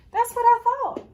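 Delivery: high, loud relieved exclaim; little girl cheering up, pitched high; relieved delight. A narrator's reading. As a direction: burst out in relief. Relieved child in story.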